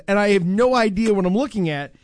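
normal talking voice

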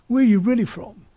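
ordinary delivery

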